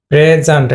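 PRE-zant, first syllable as "pre"; noun pronunciation. Shown as a demonstration. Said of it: "এটা noun হলে প্রেজেন্ট".

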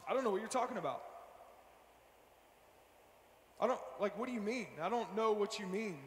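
soft, low, dodging mumble; hushed evasive denial. Retold or imitated. Young [name] to friend Terrence, quietly dodging the question. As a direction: play dumb under your breath.

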